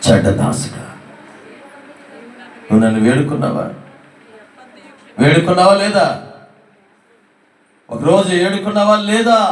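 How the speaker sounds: stern rebuke rising in anger; angry master confronting his servant. A narrator's reading. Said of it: "యజమానుడు కోపం వచ్చింది".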